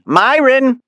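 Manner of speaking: high, bright mother's voice calling her son; Mom voice. A narrator's reading. Mother calling her son on the phone, high and chirpy.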